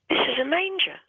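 a sudden high, amazed "This is a manger"; awed realization. Retold or imitated. Awestruck realization, retold quote.

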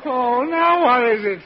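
loud, high, slow wail of annoyance; exasperated groan. Acted. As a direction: moan it, at the end of patience. Father disturbed again.

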